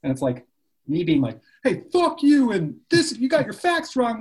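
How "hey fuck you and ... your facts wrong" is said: high, loud, hammed-up angry yell; mock angry outburst. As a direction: bark it like a mock online troll fight. Mock rant at online troll.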